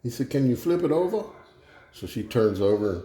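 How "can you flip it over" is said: high-pitched, lightly Cajun question; Cajun accent. Acted. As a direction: ask with a touch of Cajun. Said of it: "you guys wanna hear a Cajun joke".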